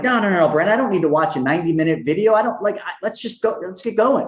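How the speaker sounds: high, hurried pushback; impatient refusal. Retold or imitated. Refusing Brent's video, impatient and high. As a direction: wave off the video, itching to start.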